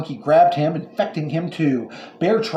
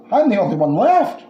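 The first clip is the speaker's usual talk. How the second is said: worried; 8 semitones higher, 6 dB louder than usual. A narrator's reading. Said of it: "worried Australia".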